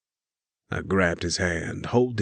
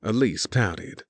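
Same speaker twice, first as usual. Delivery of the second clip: plain reading voice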